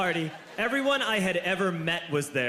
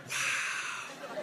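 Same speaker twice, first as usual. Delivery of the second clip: soft breathless whisper; whispered wow; 8 dB quieter than usual. Retold or imitated. Cop quietly impressed by drunk kids, whispered. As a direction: hush it, half-impressed. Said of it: "He was like".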